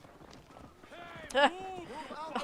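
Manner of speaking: a bright burst of laughter; amused laugh; real laugh. Real, not acted. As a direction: laugh off a near miss. Laughing at camel near-miss.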